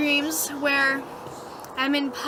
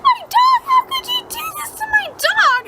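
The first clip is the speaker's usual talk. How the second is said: very high, loud squealed wail; mock crying; 6 dB louder than usual. Dreamer confronts woman over dying dog, hammed-up crying wail. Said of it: "I'm crying".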